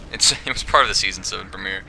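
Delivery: ordinary talk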